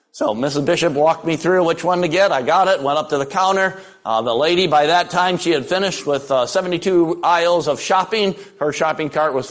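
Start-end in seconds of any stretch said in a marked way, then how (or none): none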